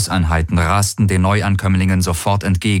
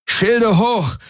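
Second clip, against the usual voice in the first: much higher, urgent shouted command; roaring order; 14 semitones higher than usual. A narrator's reading. The pilot shouts to raise the shields under fire. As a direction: bark it over the battle noise. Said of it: "brüllte Garland".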